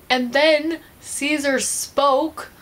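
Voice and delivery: very slow, high, suspenseful build; dramatic reveal. Real, not acted. Vlogger reveals the ape's first word, dramatically drawn out. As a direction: stretch every word for the big reveal.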